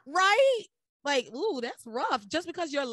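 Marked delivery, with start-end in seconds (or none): 0.1-0.6 high, loud, disbelieving right; can you believe this; incredulous. Real, not acted. Host asking co-host to agree, high loud disbelief.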